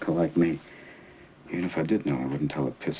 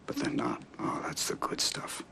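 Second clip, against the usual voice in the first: tender near-whisper; 7 dB quieter than usual. Acted. Widower telling Will about imperfections, tender whisper. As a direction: let it sink to a fond whisper. Tender reflection on late wife's quirks.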